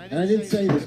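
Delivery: ordinary delivery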